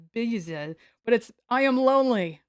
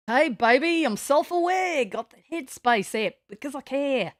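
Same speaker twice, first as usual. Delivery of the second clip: put-on higher, playful Australian accent; 4 semitones higher than usual. Streamer joking to chat in an Australian accent. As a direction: slip into a cheeky Australian accent. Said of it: "drop into an Australian accent".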